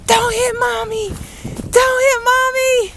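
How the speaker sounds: high, slow squealed begging; high playful plea. Real, not acted. Mom begging child not to hit with snow, high drawn-out plea. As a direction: plead high, stretching each word. Mom pleading as snowball threatens.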